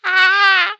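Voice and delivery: sudden high, very loud wail; loud anguished cry. Real, not acted. Reader cries out emotionally.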